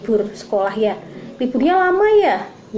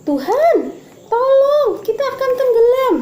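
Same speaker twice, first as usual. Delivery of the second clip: higher and louder mock cry of terror; panicked cry for help; 12 semitones higher, 4 dB louder than usual. Disciples crying to Jesus in the storm, panicked. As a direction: call out as frightened disciples about to sink. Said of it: "Terus mereka teriak".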